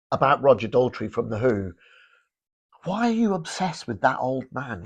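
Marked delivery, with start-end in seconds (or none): 2.8-4.9 imitating ex-wife. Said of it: "kept saying to me".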